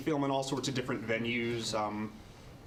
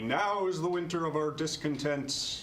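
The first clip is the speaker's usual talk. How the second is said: Shakespearean recital; 5 semitones higher than usual. Acted. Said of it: "Oh, that's very well done".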